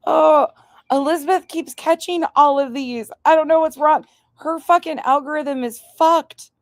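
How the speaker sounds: high, loud giggly exasperation; high-pitched amused complaining; amused rant. Real, not acted. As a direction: rant high and tickled about her feed. Laughing rant about the algorithm.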